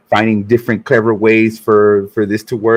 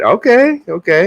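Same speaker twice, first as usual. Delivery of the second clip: high rising amused okay; amused surprise; 11 semitones higher than usual. Real, not acted. Reacting to the put-on voice.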